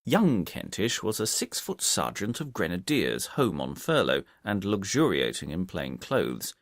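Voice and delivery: ordinary delivery, nothing marked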